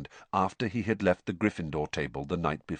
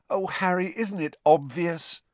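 higher, exasperated plea; despairing; 11 semitones higher, 3 dB louder than usual. A narrator's reading. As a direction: sigh it at him in despair. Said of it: "Hermione said despairingly".

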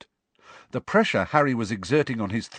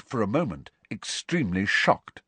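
usual speaking voice

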